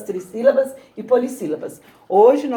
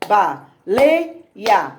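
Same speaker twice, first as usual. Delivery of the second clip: "Baleia" beaten out as ba, LEI, a; clapped-out syllables; 2 semitones lower than usual. Shown as a demonstration. Teacher clapping out "Baleia" for the class, LEI stressed. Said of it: "Ou batendo palma".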